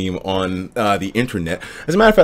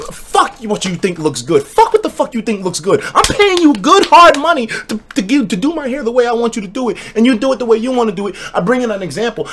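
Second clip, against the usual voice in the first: high, heated mock rant at the barber; retold outburst; 9 semitones higher than usual. Customer cussing out the barber over the cut, heated and high. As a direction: vent at the barber, pitch raised. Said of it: "And it's like".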